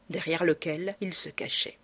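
usual speaking voice

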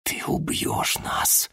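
slow, urgent whisper. Acted. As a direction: hiss the warning under your breath. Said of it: "прошептал я".

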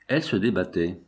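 plain reading voice

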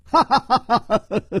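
high ringing laugh of amusement; hearty laugh. Acted. Chu Liuxiang catching Xue at the tunnel, high laugh. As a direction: laugh out as if you have caught him. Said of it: "楚留香微笑着说".

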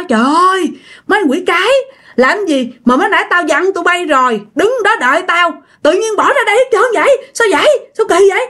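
high-pitched acted telling-off; scolding. Frightened woman scolds friends who left her behind, high-pitched scolding. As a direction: scold in a high, agitated storyteller's voice. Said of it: "đi ra chửi tụi nó".

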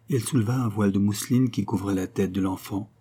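plain reading voice

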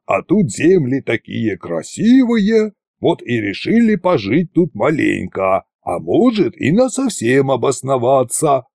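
lightly voiced, slow-spoken tsar; deliberate, scheming tsar; tsar coaching his dragons. A narrator's reading. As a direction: dictate a sly cover story with drawn-out words. Tsar briefs dragons, acted figure.

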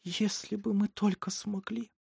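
soft, slow, high whisper; whispered longing. A narrator's reading. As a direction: wish faintly and hopelessly. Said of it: "прошептала женщина".

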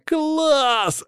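very high, stretched cheer; overjoyed, high-pitched exclamation; delighted. A narrator's reading. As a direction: squeal it with joy. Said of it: "обрадовался Боря".

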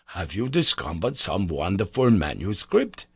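usual speaking voice

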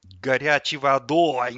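loud, slow, grumpy echo; disgruntled grumble. A narrator's reading. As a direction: throw it back in loud displeasure. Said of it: "недовольно буркнул тот". (недовольно, громко ворча)

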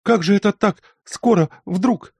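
high, loud bewildered questions; a flustered mother thrown by sudden news; flustered surprise. A narrator's reading. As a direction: caught off guard, words tumbling out. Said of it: "растерявшаяся Марья Степановна".

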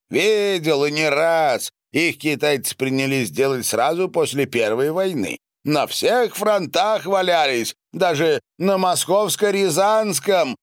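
high, loud emphatic recollection; loud declaiming. A narrator's reading. Count recalls the mines to the company, declaiming loudly. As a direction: hold forth forcefully, pitch well up.

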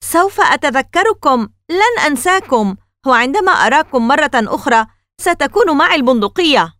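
high, loud threat shouted after them; the old man yells after the fleeing boys; angry yell. A narrator's reading. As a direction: yell a threat at their backs. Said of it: "صاح الرجل وراءهم".

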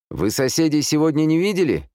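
high-pitched shouted question; calling out. A narrator's reading. Armed man questions Amanda by the car, shouting. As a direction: call across the street to a stranger. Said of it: "крикнул он ей".

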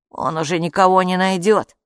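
usual speaking voice

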